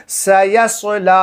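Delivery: slow سیصلی with a heavy rounded saad; سیصلی drawn out, full "so" in the middle; correct saad. Shown as a demonstration. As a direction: model سیصلی, thin s then dark s. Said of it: "کیسے پڑھیں گے".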